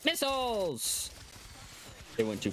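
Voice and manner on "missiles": shouted warning. Real, not acted. Warning squad of incoming missiles.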